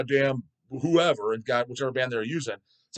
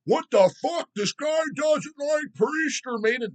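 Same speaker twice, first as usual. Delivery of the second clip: much higher, slow, mock outraged shout; mocking outraged fan; 3 dB louder, slower than usual. Fans baffled he skips Maiden, mock shrieking. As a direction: parody the shocked metalhead, yelling high. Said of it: "They're like".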